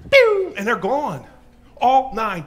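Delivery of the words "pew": loud high zipping whoosh; a loud, high zip as someone dashes off; whoosh sound effect. Acted. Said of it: "sound of leaving".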